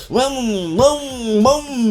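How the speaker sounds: high, rising engine-rev noises; engine revving imitation. Rev it with rising high sounds. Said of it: "My little Toyota Corolla".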